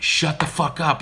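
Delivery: aunt yelling at the cussing parrot; fed-up yell. Retold or imitated. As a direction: snap back at the bird, sick of it. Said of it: "my Aunt Barbara would be like".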